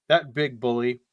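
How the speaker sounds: ordinary talk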